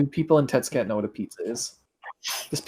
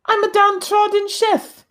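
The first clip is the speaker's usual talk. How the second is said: meek character voice. Pretend. Player voicing character feigning ignorance.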